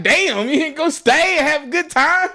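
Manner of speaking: high-pitched, laughing disbelief; incredulous protest. Retold or imitated. Acting own dismay at friend leaving.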